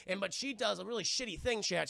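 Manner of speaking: ordinary delivery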